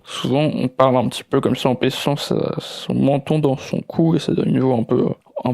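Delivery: muffled voice, chin tucked into the neck; chin-down voice demo. Shown as a demonstration. Coach showing the wrong way to deepen voice, muffled chin-tucked tone. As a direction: speak with the chin pressed down, closed-in throat. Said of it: "là, je vais le faire".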